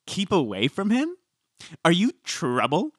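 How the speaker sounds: high, rising questioning echo; mock questioning echo. Co-host acting out the reply to Lisa, high mock questioning. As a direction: repeat it back high, as a question. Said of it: "And then she goes".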